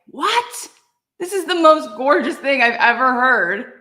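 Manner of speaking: high, loud astonished cry; thrilled disbelief at a gorgeous idea; amazed. Retold or imitated. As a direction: blurt it out, stunned by beauty. Reacting to the rabbi's teaching.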